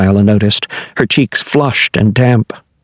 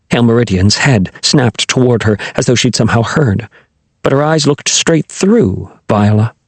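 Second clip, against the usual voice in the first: normal talking voice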